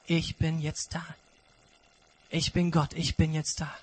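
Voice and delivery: gentle friendly God voice. Acted. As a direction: speak like a close friend in the dark. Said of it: "wie die Stimme eines Freundes".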